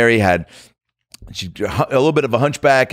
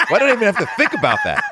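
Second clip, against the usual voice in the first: higher, quick laughing words; faster than usual. Real, not acted. Laughing at his own pause over the question, laughing.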